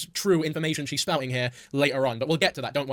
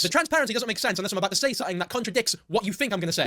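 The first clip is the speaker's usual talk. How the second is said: ordinary talk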